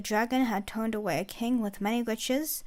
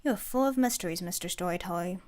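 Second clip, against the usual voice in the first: ordinary delivery, nothing marked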